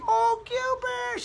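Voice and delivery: put-on drawn-out, high-pitched delighted old lady; happy greeting. Older lady greeting him at her church, happy. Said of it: "She was happy to see me".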